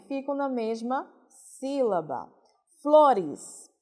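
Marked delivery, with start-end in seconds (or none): 2.8-3.7 Flores. called out clearly, F and L kept together; loud example word. Shown as a demonstration. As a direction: present Flores to the class with emphasis. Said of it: "o F e o L ficam aí na mesma sílaba". (Flores dito em voz alta para a turma)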